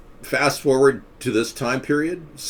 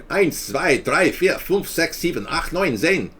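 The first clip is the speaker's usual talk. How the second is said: put-on high, sharp German-accented count; mock German numbers count; 8 semitones higher, slower than usual. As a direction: count in German like a drill sergeant. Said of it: "Why are you using this Nazi voice".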